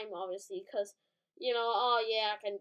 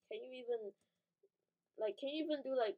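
usual speaking voice

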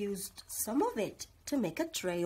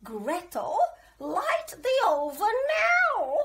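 much higher, loud cackly witch voice; 9 semitones higher, 9 dB louder than usual. A narrator's reading. Old witch inviting the children in, high and loud. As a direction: lure them in like a sweet-talking witch. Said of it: "she said".

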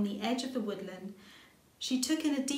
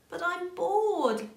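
high, slow, louder whiny moan; bored moan; 9 semitones higher, 5 dB louder, slower than usual. Acted. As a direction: drag it out like a bored little bunny. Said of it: "Little Rabbit moaned".

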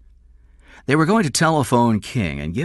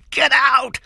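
high, prolonged furious screams; shouting; 4 dB louder, slower than usual. A narrator's reading. King still shouting after Cameron has left, enraged. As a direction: scream after him at full pitch. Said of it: "he was still shouting".

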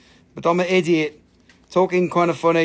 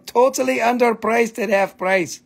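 ordinary talk

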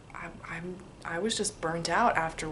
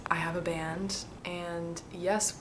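normal talking voice

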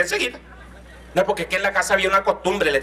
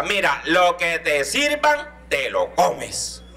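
stern mother warning; much slower than usual. Retold or imitated. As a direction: lay down the law slowly like a strict mom. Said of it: "Mi mamá me dijo".

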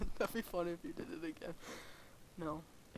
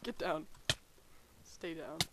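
ordinary talk, nothing marked

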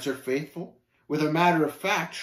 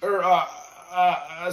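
at a loss for words; halting, flustered search for a reply; stammering; 3 semitones higher, 4 dB louder than usual. Acted. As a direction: stumble over fillers, pitched up a little. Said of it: "stammered talkative, at a loss for words".

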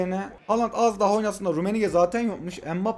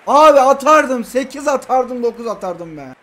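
loud rant; 3 semitones higher, 7 dB louder than usual. Real, not acted. Ranting about deserved score.

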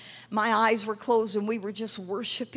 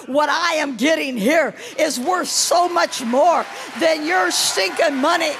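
loud, emphatic, raised declaration; retold defiant refusal; 4 semitones higher, 5 dB louder than usual. Poor woman refuses her family's bribe, defiant shout as retold by preacher. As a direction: throw the offer back forcefully.

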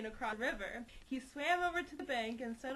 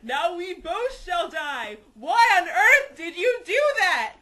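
croaking frog; 6 semitones higher, 17 dB louder than usual. Acted. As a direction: bellow it like a dying frog, half laughing. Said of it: "croaked the frog".

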